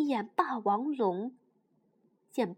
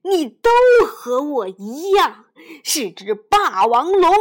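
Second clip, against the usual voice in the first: big booming dinosaur, insisting; a loud rough dinosaur voice claiming the little one; loud T-rex voice; 13 dB louder than usual. Acted. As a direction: boom it out like a tyrannosaur. T-rex claims kinship with hero.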